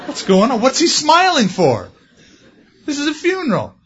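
baffled, high and loud; incredulous whisper-turned-outcry of confused friends; puzzled mourners. Retold or imitated. Friends who don't know Christ puzzle over the smiling, high and loud.

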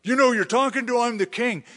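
a hammed-up angry royal rant; furious king. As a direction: play the outraged king demanding respect. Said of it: "King Uzziah was furious".

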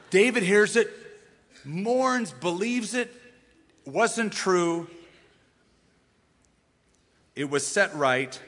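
ordinary talk, nothing marked

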